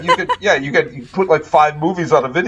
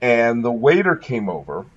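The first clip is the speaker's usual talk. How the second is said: normal talking voice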